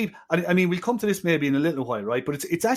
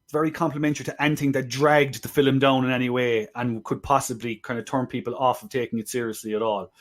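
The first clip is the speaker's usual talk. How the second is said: normal talking voice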